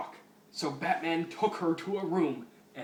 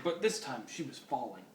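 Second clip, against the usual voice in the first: usual speaking voice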